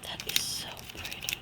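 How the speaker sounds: very soft whispered wonder with little gasps; hushed, breath-taken delight; awed whisper. Real, not acted. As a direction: whisper in admiration, barely voiced. Awed by the paint colors.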